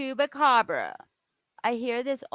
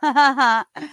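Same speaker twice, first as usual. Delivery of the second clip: loud laugh; 4 semitones higher, 8 dB louder than usual. Real, not acted. Laughs at video.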